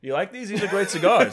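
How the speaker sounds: loud, eager cigar pusher; role play enthusiastic smoker. Pretend. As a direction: sell it loudly, high and keen. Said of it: "we're gonna role play".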